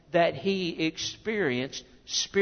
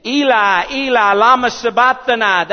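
loud preacher's cry of anguish from scripture; loud, raised scriptural outcry; crying out loudly; 4 semitones higher, 9 dB louder than usual. Acted. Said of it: "cried out with a loud voice".

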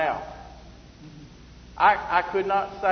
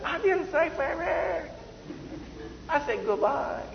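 mock Japanese or Chinese accent; 12 semitones higher, 5 dB quieter than usual. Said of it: "this Japanese or Chinese, you know, dialect".

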